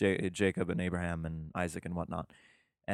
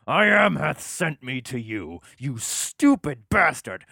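higher, louder and slow, fuming; mad God; 6 semitones higher, 7 dB louder, slower than usual. Acted. God losing patience with Moses, mock angry. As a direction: spit it out like an exasperated god. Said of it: "he gets kinda mad".

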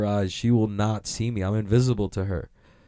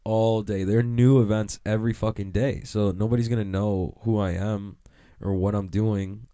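usual speaking voice

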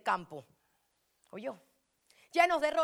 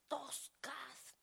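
choked whisper; 14 dB quieter than usual. Real, not acted. Emotional about worker's hands.

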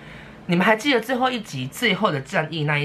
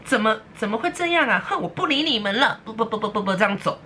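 mock sulky huff; 6 semitones higher than usual. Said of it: "孟子就在那边不爽说".

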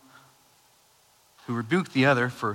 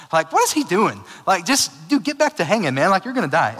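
louder, higher frustrated scolding; exasperated rant; 6 semitones higher, 6 dB louder than usual. Real, not acted. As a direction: scold the criminal like a pal. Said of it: "It just frustrates me".

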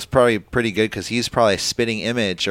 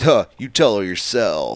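slow, louder put-on voice; mock retort; 5 dB louder than usual. Constable answering the manager on the phone, slow mock retort. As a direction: lean slowly into an imitated comeback. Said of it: "and he goes".

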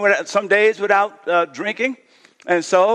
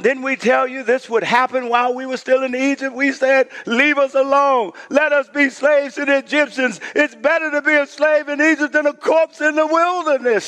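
whiny complaint, pitched up; complaining Israelites; 6 semitones higher than usual. Acted. Preacher voicing the Israelites turning on Moses, mildly whiny. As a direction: voice the grumbling crowd, a little higher.

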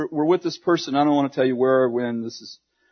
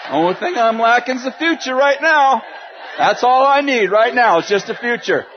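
louder, laughing through each line; 5 semitones higher, 4 dB louder than usual. Real, not acted. Preacher laughing to the crowd about lacking the future, loud laughing. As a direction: laugh at the absurdity of it. Laughing at his own predicament.

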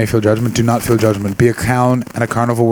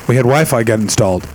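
ordinary delivery

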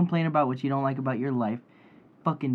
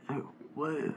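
much softer dazed mock voice; stoned listener; 10 dB quieter than usual.

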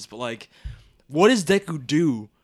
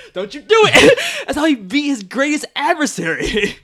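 tearful; 11 semitones higher, 8 dB louder than usual. Real, not acted. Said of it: "I'm gonna cry".

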